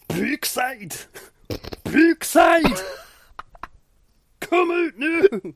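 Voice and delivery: loud, high Scottish wrestler shouting; Scottish accent. Retold or imitated. Scottish wrestler calling out his rival, Scottish accent shouting. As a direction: bellow the name in a thick Scottish accent. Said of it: "This accent?".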